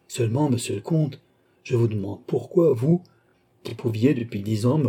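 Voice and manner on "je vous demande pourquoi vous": ordinary delivery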